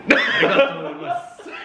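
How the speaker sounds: high, laughing mock Japanese phrase; a jokey put-on Japanese "arigato gozaimasu". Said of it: "You're being racist".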